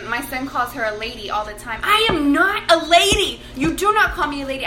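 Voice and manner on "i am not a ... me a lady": mock outrage, spacing out each word; imitated indignant child. Voices daughter indignantly correcting her brother.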